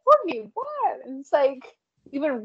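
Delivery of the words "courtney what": baffled. Retold or imitated. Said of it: "Rick is all like".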